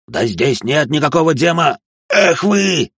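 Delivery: high protest climbing to a yell; desperate shout. A narrator's reading. Demonologist protesting to attacking demons, shouting desperately.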